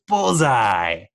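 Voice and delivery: a loud, gleeful mock cry of triumph; manic villain shout. Villain over the body of his victim, loud gleeful cry. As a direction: bark the name out like a proud maniac. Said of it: "he just goes".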